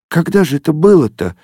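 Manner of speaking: high tearful question; sobbing question. A narrator's reading. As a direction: ask it through a sob. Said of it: "всхлипнула Зося". (со всхлипом)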